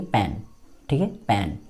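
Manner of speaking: पैन with an open a, like pan. Shown as a demonstration. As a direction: stretch the vowel in पैन. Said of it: "देखिए इसको क्या बोलेंगे". (पैन को खुले 'ऐ' से बोलिए)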